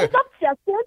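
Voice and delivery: SpongeBob voice. Pretend. SpongeBob actor in character.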